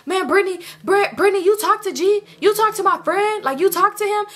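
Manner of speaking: high-pitched insistent interrogation; hammed-up demanding questions; mock jealous questioning. Chris interrogating Brittany.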